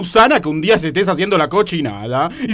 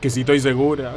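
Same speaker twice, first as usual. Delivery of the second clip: low, soft, unruffled answer; subdued reply; 6 semitones lower, 5 dB quieter than usual. Acted. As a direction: answer low and under your breath. Repeats answer, first take subdued.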